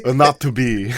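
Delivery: slow action-hero Hamlet; Arnold Schwarzenegger. Acted. Action star in a fake Hamlet movie, slow imitation. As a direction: drag it out as a put-on action star. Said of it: "shows him in a fake movie of Hamlet".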